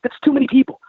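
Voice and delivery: high-pitched exasperated protest; retold incredulous insistence. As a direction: act out insisting, voice jumping way up. Retold insistence on the extra passenger.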